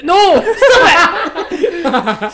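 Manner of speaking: loud high laughing protest; laughing shout. Real, not acted.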